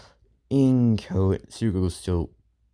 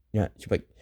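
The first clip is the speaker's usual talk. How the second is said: ordinary delivery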